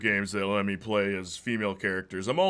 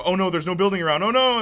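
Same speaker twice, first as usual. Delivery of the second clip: mock panic; 6 semitones higher, 6 dB louder than usual. Mock panic about falling.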